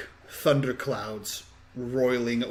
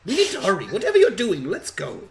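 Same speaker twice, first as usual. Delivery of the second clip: put-on bratty kid demands, jumping high and loud; sassy; 7 semitones higher, 4 dB louder than usual. Girl hurrying an old witch along, sassy and high. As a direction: sass them like an impatient, bossy child. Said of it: "she sasses you".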